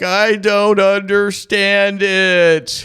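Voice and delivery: high, loud, slow wail; hammed-up sobbing complaint; Penny faking tearful despair; mock crying. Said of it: "she's like".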